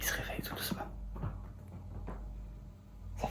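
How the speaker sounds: soft whisper beside the bed. Real, not acted. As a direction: whisper gently as he wakes. Man waking up nearby.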